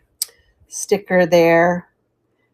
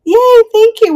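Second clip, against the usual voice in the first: high, loud happy squeal; excited yay; 7 dB louder than usual. Real, not acted. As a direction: cheer the raiders in. Thanking raiders.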